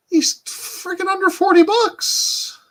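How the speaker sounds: loud, very high, drawn-out exclamation; amazed outburst. Real, not acted. Streamer spotting a cheap figure price, amazed outburst.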